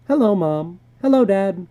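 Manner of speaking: put-on bright kid's hello; child voices. A narrator's reading. Children enter greeting.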